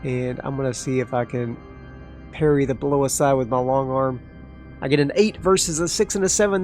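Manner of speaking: ordinary talk, nothing marked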